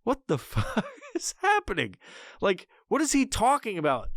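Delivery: high, laughing what-the-hell; half laughing, baffled; laughing disbelief. Retold or imitated. Father walking in on the science video, laughing in disbelief. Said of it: "I walked into the room and I'm like".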